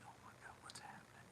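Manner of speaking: fast breathless whisper of alarm; a frightened aside under the breath; panicked whisper. Retold or imitated. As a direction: hiss the thought out in a rush of panic. Panicked inner thought in car.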